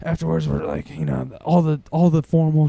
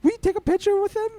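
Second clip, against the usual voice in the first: excited fan mom; faster than usual. Retold or imitated. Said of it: "the mom goes".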